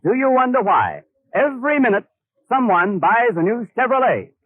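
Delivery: ordinary delivery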